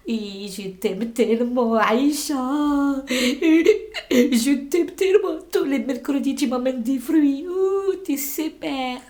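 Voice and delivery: put-on slow, laughing, gushing old neighbour voice; doting old lady. Old Isabelle hugging Aïcha, slow laughing gush. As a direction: stretch it out like a tipsy granny hugging you.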